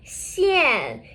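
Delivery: "Xian" blended into one long falling syllable; falling-tone "Xian" as a model for learners; fourth tone. Shown as a demonstration. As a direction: glide "Xian" together and let the pitch drop. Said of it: "with the fourth tone".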